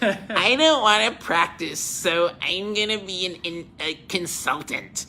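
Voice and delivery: lightly voiced slow, lazy buddy; a hint of a doctor who skips residency, drawn out; buddy imitation. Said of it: "He was like".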